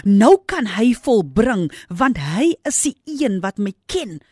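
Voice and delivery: fervent pulpit cry; preaching at full force. Acted. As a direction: build the sermon to a climax.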